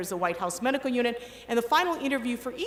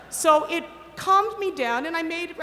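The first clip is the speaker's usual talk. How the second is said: ordinary talk, nothing marked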